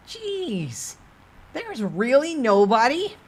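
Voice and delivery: squeaky merchant sprite teasing the player. Acted. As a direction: sell it like a sly carnival barker. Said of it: "the Doc Aebi's merchant-like voice".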